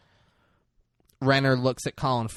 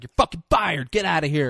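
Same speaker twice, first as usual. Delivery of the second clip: higher-pitched retold captain's shout; mock angry firing; 4 semitones higher than usual. Captain firing Renner in his office, mock angry shout. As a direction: throw him out in a mock shout.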